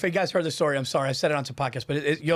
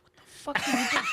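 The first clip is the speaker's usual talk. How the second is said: shocked friend, laughing; 11 semitones higher than usual. Retold or imitated.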